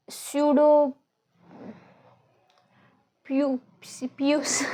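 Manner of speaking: Pseudo- pyu- pius- fumbled tries at sounding the p; trying the p. Shown as a demonstration. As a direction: grope for a way to say pius- with the p. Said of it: "I'm gonna pronounce this anyhow".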